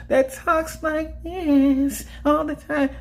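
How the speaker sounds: put-on high, drawn-out old lady voice; slow, high-pitched imitation of a little old lady; Tangina Barron impression. YouTuber imitates the old lady from Poltergeist, high slow voice. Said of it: "I'm not doing a perfect impression of her".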